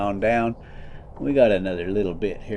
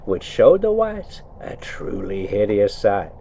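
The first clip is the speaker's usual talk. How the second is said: put-on reading the old tale aloud, a touch storyteller; story narration; 6 semitones higher than usual. A narrator's reading. Trucker reading to his viewers while driving, story narration. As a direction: read it like a bedtime book. Reads Arabian Nights aloud.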